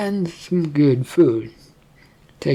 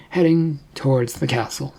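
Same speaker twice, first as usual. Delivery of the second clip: ordinary delivery, nothing marked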